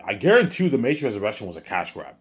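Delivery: ordinary talk, nothing marked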